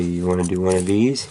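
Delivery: normal talking voice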